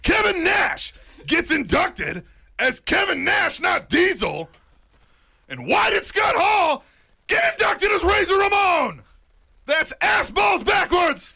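indignant shouting rant. Real, not acted. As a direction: bellow it like a fired-up promo. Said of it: "scream my answer back".

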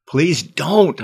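pleading with friend at cliff edge; begging. Acted. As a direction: beg him to stop. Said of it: "I begged".

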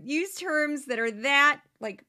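normal talking voice

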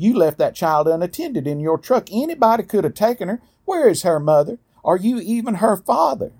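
high, fast scolding woman's voice; shrill accusing busybody. A narrator's reading. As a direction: pitch her up and rattle off the accusations. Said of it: "raised her already shrill voice".

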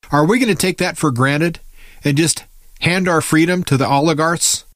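ordinary talk, nothing marked

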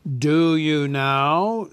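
a dragon pleased by praise; flattered dragon. Acted. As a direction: purr it, slowly and loudly. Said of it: "said the dragon, somewhat flattered".

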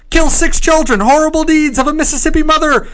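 high, loud newsboy hawking headlines; old-timey paperboy crying the news; newspaper kid. Pretend. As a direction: shout the headline like a street newsie. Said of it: "read these like a newspaper kid".

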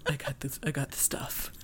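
hushed, secretive whisper; whispered dealer. Pretend. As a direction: whisper like a smuggler. Playing secret butter dealer.

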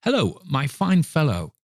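high, hearty greeting; delighted high-pitched hello; jovial hail. Acted. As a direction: call down cheerily to a boy in the street. Scrooge hails boy in street.

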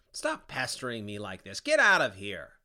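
loud angry dismissal; angry shout. A narrator's reading. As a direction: bark him away. Sinner drives Dante away.

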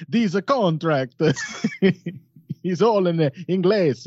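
high, slow mock Russian accent, laughing; hammed-up Russian accent through laughter. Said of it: "Here's my Russian".

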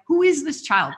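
marveling, baffled question; retold amazement. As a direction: exclaim it in playful disbelief. Retold amazement at her baby.

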